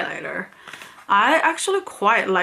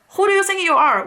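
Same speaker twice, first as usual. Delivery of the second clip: sharp, high, louder indignant question; indignant outburst; 10 semitones higher, 3 dB louder, faster than usual. Real, not acted. Vlogger fuming at a rude customer service agent, indignant outburst. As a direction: throw it back at the rude agent in outrage.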